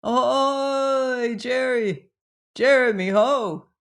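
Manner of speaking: high, calling hail across the woods; mournful sailor's halloo, pitched high; plaintive cry. Acted. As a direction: cry out for a lost shipmate, pleading. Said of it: "his hoarse and plaintive cry".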